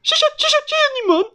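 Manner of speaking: very high, stuttering hen voice; high, loud, quick character voice; flustered, grateful mother hen. Acted. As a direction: gush thanks like an excitable hen. Mother hen speaks in story.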